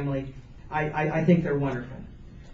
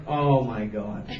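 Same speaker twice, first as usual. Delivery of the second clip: mortified disbelief; 7 semitones lower, slower than usual. Retold or imitated. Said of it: "I was like".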